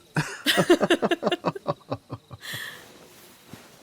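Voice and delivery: helpless, squealing high laugh; high burst of laughter. Acted. Servant laughing hard at the queen's claw-marks joke. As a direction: let a high giggle burst out and run long.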